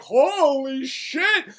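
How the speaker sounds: stunned, slow exclamation; acted awe. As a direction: stretch it out in mock astonishment. Soldiers reacting in awe.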